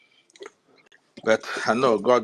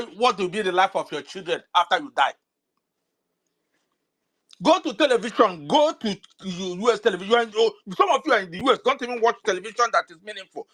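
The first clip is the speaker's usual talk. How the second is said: raised, urgent, fast scolding harangue; heated rant; 7 semitones higher than usual. Real, not acted. A speaker on a live space scolding diaspora supporters, heated and high. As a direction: scold the listeners at full pitch, pressing hard.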